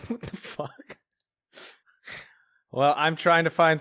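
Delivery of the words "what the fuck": soft, slow, breathy disbelief; shocked whisper. Real, not acted. Reacting to a meme on screen, whispered shock.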